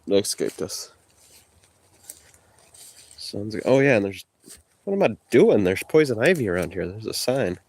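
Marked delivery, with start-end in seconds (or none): none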